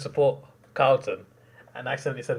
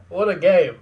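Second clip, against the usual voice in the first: loud, high burst of disbelief; thrilled shout to the stream viewers; excited exclaim; 6 semitones higher, 11 dB louder than usual. Real, not acted. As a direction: shout it out at the final siren. Exclaims after the final siren.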